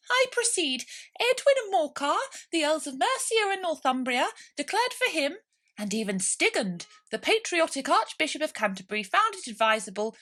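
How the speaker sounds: very high, loud pompous mouse lecturing; a tiny schoolmaster reciting dry history; important mouse. A narrator's reading.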